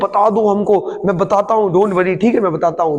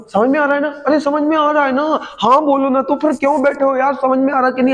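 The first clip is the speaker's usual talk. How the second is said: prodding the class; 6 semitones higher than usual. Real, not acted. Teacher prodding silent class.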